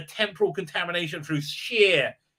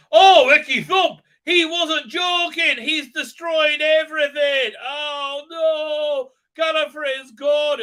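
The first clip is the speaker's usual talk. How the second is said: high, drawn-out hammed-up cry of dismay; mocking, slow anguished shout; mock horrified wail; 9 semitones higher, 7 dB louder, slower than usual. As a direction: wail it slowly in overblown despair. Acted horrified discovery of ruins.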